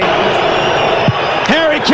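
high, slow, stretched-out shouted name; drawn-out stadium shout of the name; announcer shout. Acted. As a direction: call the name like a goal cry. Calling out player name.

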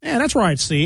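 put-on high, old-fashioned chap voice; mocking Reggie's old-fashioned comments; old-timey gentleman. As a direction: talk like someone from nineteen thirty-one. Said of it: "That's how he talks".

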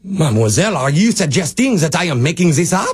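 mock French accent, playing offended; put-on French accent for a prank. Prank caller protesting to a pharmacist, in a French accent. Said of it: "you're putting on a French accent".

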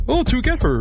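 high, singsong "to get her"; mock realization. Host mocks own typing habit, high-pitched "to get her". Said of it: "if I'm, like, typing out".

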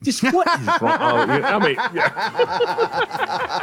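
high voice breaking into laughter; mother voice dissolving in laughs; laughing mom voice. Retold or imitated. Retelling his mother's reaction, high and laughing. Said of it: "she goes".

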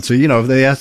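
ordinary talk

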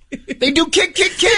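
playful high kick-kick. Retold or imitated. Imitating dog kicking grass.

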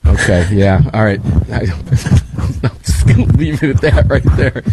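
slow, breaking up with laughter; laughing. Real, not acted. Host amused by the guest's soccer remark, laughing. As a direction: talk through a real laugh.

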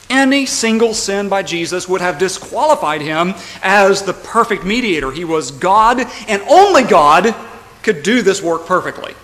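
emphatic pulpit delivery building to a loud peak; forceful preaching. Real, not acted. Preacher insisting only God could mediate, forceful preaching. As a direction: build until the last words ring out.

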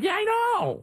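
mock squeak of agreement; squeaky mock reply. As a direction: pitch it way up like a wry aside. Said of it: "I'm like".

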